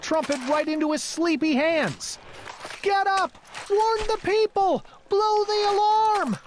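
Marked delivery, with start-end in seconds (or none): 2.8-6.4 urgent wake-up call. Acted. Bartholomew rousing the sleeping trumpeter, urgent and higher. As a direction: shake him awake with it.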